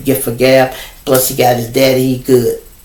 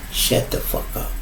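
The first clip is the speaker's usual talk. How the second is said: whispered shut up; 9 dB quieter than usual. Shown as a demonstration. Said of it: "I'ma say that again".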